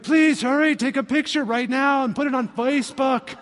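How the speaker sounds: high, urgent mock excitement; acting out someone desperate to show off; eager plea. Mocking eager request for photo.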